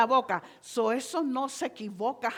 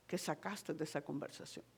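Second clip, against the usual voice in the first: quiet aside; 6 semitones lower, 12 dB quieter than usual. Retold or imitated. Imagined listener's quiet remark.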